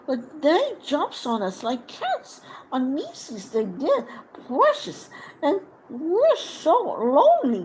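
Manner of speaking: high, wheedling creature voice; cringing Gollum begging to be spared; pleading Gollum. Acted.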